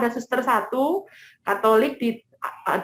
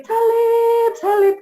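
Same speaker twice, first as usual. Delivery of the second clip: high, loud, drawn-out cry; imitated screaming; 10 semitones higher, 5 dB louder, slower than usual. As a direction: re-enact crying out in delirium, high and long. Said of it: "Saya teriak".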